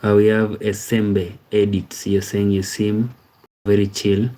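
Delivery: ordinary talk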